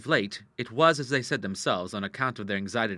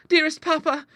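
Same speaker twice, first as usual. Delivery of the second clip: put-on very high, louder girlish appeal; pleading cry; 6 dB louder than usual. A narrator's reading. Daughter embraces angry father, crying out pleadingly. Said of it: "cried Clara".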